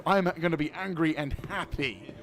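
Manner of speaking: mock-angry cheerful defiance; angry and happy. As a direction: declare it defiantly, refusing to lose your temper. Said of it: "I'm gonna refuse to get angry".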